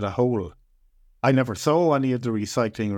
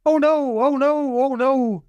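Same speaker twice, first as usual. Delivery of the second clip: high, swooping run-together wail; drawn-out high lament; 12 semitones higher than usual. A narrator's reading. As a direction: wail it like a tiny doomed creature. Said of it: "the voice wailed".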